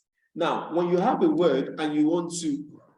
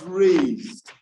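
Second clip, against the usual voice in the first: RAISED drawn out and loud; raised emphasis; 5 dB louder than usual. Shown as a demonstration. Teacher explains raised pitch, stressing RAISED. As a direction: punch and stretch the word raised. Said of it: "when you are pronouncing it".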